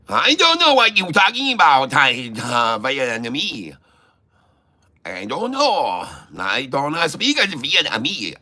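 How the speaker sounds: swooping, badly faked Vietnamese accent; mock Vietnamese accent. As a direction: swing the pitch wildly in a fake Vietnamese accent. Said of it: "I cannot do the Vietnamese".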